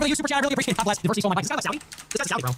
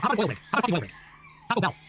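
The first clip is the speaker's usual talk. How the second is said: usual speaking voice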